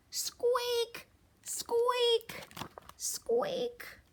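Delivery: high, breathy squeaky mouse; little mice squeaking away. Acted. Mice sound in story.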